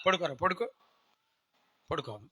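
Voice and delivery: put-on soft parent lulling a baby; imitated bedtime hushing. Parents putting the baby to sleep, imitated softly. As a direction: drop softly, as if settling an infant. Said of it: "అని పడుకోబెడుతున్నారు వీళ్ళు".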